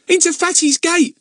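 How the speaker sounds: put-on high, loud excited young lad; excited boy voice. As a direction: blurt it out like an eager boy. Narrator voices Ern.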